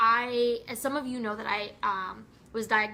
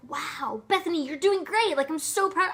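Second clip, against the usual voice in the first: higher and louder, gushing cheer; excited praise; 5 semitones higher, 4 dB louder than usual. Pretend. Cheering on her successful friend Bethany, gushing excitement. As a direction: beam with pride at her friend. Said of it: "I'm like".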